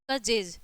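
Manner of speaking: "कजेज" with j for both g and final s; j sounds. Shown as a demonstration. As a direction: say "कजेज" with a j in the middle and at the end. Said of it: "ज हो जाएगा". ("कजेज" में बीच और अंत में ज)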